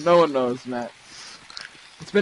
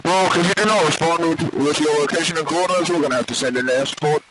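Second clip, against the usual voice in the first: mock radio dispatcher. Plays dispatcher asking location.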